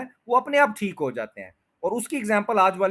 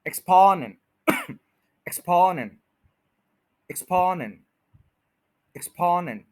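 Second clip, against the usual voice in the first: Exponent with स्पॉ stressed, first take a little louder; stress on spo; 3 dB louder than usual. Shown as a demonstration. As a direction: repeat exponent hitting the second syllable each time. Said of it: "उसके ऊपर पूरा stress करना".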